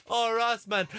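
usual speaking voice

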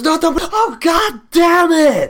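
very high, loud, slow hammed-up yell; mock furious shout. Imitating the airport officer losing it, hammed-up shout. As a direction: roar it like an exploding officer, overdone.

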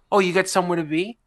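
mildly voiced nosy grandmother; grandma's sass. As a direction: needle him playfully. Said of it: "And she goes".

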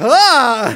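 long, very loud "ah" of fright; startled mock scream. Knight acting out surprise and fear, mock scream. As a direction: imitate a surprised, scared knight yelling. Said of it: "as in surprised and scared".

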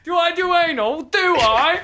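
high, loud squealed question; hammed-up high-pitched squeal; squealing mock seduction. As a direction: squeal it like a shocked joke.

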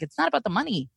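exasperated comeback, played up for the story; indignant retort. Acted quarrel with the gym salesman.

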